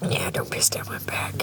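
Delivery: breathy whisper, half to herself; whispered line. Pretend. Talking to herself about the bed, a hushed whisper. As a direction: say it like a secret joke. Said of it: "I love saying that".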